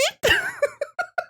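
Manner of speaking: very high giggling fit; squealing laughter at your own silly voice. Real, not acted.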